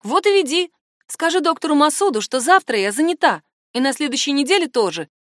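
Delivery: high, forceful dismissal; angry retort. A narrator's reading. Woman ordering her assistant to refuse the doctor, irritated. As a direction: snap back and give orders.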